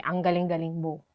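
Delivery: mock praise on "Ang galing-galing mo"; sarcastic. Shown as a demonstration. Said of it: "change your tone to make it sarcastic".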